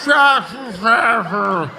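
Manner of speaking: slow, slurred, groaning mock of a heckler; hammed-up slurred groan; mocking slurred stroke voice. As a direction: imitate a drunk heckler slurring. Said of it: "you sounded like you just had a fucking stroke".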